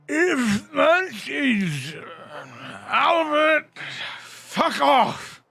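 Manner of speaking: put-on high, very loud, drawn-out drunken yell; slurred shout. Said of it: "slurred, very much slurred".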